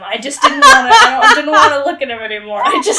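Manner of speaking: loud laughing rant, words tumbling out. Real, not acted. Podcast host laughing at the pages she hated, loud and fast.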